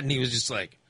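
ordinary delivery

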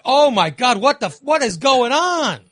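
high, loud panicked "oh my God"; shocked outburst. Retold or imitated. Telling cohost his toilet disaster, loud high panic. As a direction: blurt it out in horrified disbelief.

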